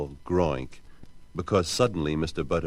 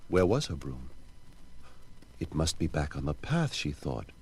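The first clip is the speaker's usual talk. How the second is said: plain reading voice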